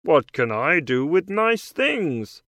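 lightly voiced frog character; a hint of a croaky frog. A narrator's reading. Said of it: "says the frog".

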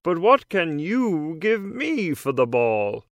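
lightly voiced frog character; a hint of a croaky frog. A narrator's reading. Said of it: "asks the frog".

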